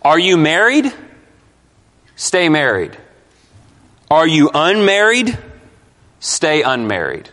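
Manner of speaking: MARRIED and UNMARRIED rising as questions, then falling firm answers. Shown as a demonstration. Preacher showing a better reading of the verse, question and answer. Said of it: "the better way to read this is".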